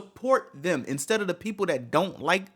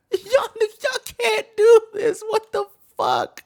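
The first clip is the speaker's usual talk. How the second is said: much higher, half-laughing, half-crying plea; pained laugh; 4 dB louder, slower than usual. Real, not acted. Streamer begging fans to stop, pained laugh. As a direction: laugh through exasperation.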